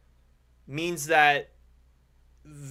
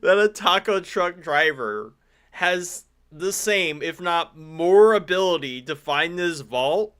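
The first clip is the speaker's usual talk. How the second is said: slow exasperated emphasis; 4 dB louder than usual. Real, not acted. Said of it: "exasperated slow emphasis".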